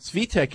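"Svitek" said SVEE-tek; "Svitek" pronounced SVEE-tek, as a correction; short I, as pronounced. Shown as a demonstration. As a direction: say "Svitek" with an EE sound in the first syllable. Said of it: "is how it is pronounced".

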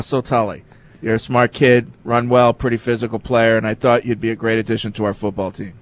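low-pitched, understated coach reading; lightly played Belichick. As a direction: read it as a coach addressing his player, lightly acted. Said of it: "I'll be Belichick".

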